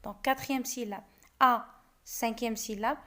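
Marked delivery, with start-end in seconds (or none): 1.4-1.7 "À" set apart as one beat; "À" as a lone, distinct syllable; isolated syllable. Shown as a demonstration. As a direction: count off "À" by itself. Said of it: "on va découper les vers".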